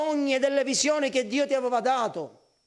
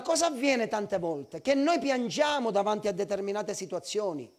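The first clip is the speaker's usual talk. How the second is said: normal talking voice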